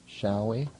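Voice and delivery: usual speaking voice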